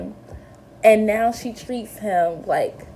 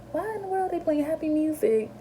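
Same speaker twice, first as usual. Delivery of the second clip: puzzled disbelief; 8 semitones higher, 6 dB quieter, faster than usual. Retold or imitated. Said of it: "I'm like".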